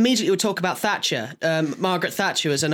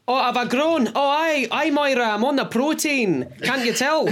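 higher, chatty Scottish impression; mock Scottish accent; 6 semitones higher than usual. Scot boasting to Moira about growing, mock Scottish accent. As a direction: play a proud Scot boasting.

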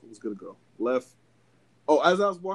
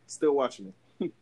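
ordinary talk, nothing marked

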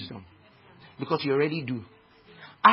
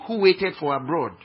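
normal talking voice

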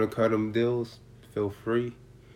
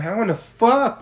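disbelief; 11 semitones higher, 7 dB louder than usual. Retold or imitated. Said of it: "I'm sitting there going".

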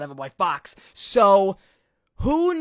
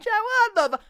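much higher, squeaky, cut off; high squeaky outburst; 13 semitones higher than usual. Shown as a demonstration. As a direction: flip back up into the squeal. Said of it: "I'm so diverse with the way I can use my voice".

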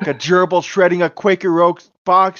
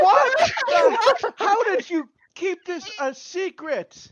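excited disbelief. Real, not acted. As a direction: squeal in disbelief.

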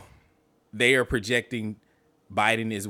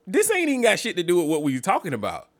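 high-pitched baffled complaint; exasperated disbelief; 8 semitones higher than usual. Retold or imitated. Reacting to Kamala's debate answer, exasperated disbelief. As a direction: plead for it to make sense.

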